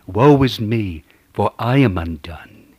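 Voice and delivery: loud anguished cry of lament; loud pleading lament. Acted. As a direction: cry it out to heaven. Preacher cries out Isaiah's lament.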